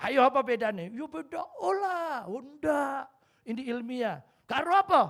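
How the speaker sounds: high, loud, pressing; mother-in-law eagerly demanding the punchline, high. Retold or imitated. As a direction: demand the answer like an impatient old mother.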